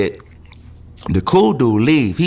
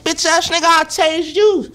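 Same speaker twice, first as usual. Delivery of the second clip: pitched-up mock challenge; mock defiant threat. Retold threat to guard.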